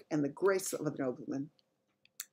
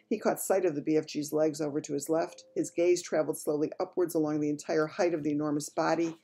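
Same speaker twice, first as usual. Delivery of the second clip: ordinary delivery, nothing marked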